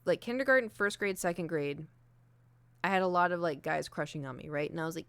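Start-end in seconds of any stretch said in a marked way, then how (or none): none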